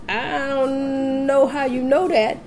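high, drawn-out doubting voice; imitated skeptical nurse. Nurse doubting the laboring mother, imitated high and drawn out. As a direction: stretch it out high in mock doubt.

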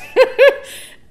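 high bursting laugh at the memory; loud laugh. Real, not acted. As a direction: laugh out at how absurd it was.

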